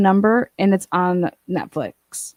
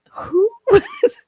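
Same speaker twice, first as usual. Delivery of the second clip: laughing; 5 dB louder than usual. Real, not acted. Laughing mid-sentence at the texts.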